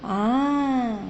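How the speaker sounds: normal talking voice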